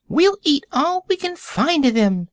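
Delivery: high squeaky piglet voice; eager piglet. A narrator's reading. Piglet promising to eat the fruit, high and squeaky. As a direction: squeal it out like a greedy little pig. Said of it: "said another".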